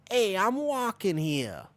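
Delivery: high, slow, drawn-out East Coast street holler; mock East Coast accent. Pedestrian objecting to someone walking past, mock East Coast accent. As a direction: put on a big East Coast accent and call it out. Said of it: "I don't have that accent".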